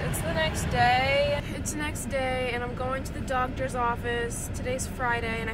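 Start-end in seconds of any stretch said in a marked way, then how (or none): 0.1-1.4 slow, stretched and louder; drawn-out announcement. Real, not acted. Vlogger greeting besties on a new day, drawn out. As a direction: draw the words right out, loudly.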